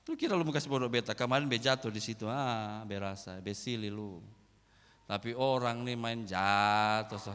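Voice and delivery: cheeky retort. Retold or imitated. Mocking retort, dialect.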